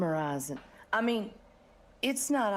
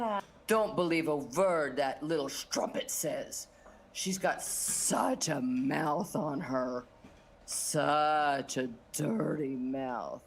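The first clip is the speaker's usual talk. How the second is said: scornful boss; 8 semitones lower than usual. Acted. Boss calling Candy a dirty-mouthed strumpet, low and lingering. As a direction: draw out the scorn in a low pitch.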